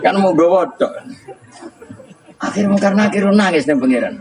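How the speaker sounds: high, still giggling through words; laughing. Real, not acted. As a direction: keep laughing as you talk. (masih tertawa sambil bercerita)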